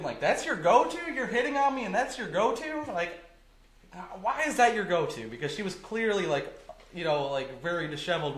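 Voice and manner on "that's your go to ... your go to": incredulous. Retold or imitated. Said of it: "I'm, like, thinking".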